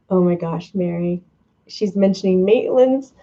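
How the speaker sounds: ordinary delivery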